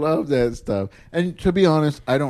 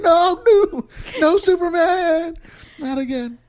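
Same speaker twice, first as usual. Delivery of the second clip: hammed-up high-pitched wail of refusal; comically traumatized, voice leaping high; mock panic; slower than usual. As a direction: cry out in exaggerated dread, drawing it out. Acting out his refusal next time.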